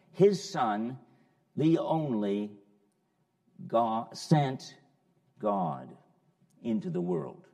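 "His Son, the only God, sent God into the world" with each phrase punched loud and set apart by pauses; emphatic word order. Shown as a demonstration. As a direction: hammer each word group, pausing between them. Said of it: "The actual order is very emphatic".

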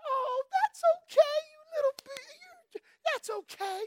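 hammed-up coddling mom, falsetto; mocking indulgent mom. Preacher mocks moms who excuse a child's attitude, high falsetto. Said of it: "I can hear moms".

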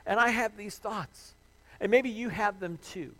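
loud, high impassioned sermon climax; full-force preaching. Acted. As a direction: preach it out with everything.

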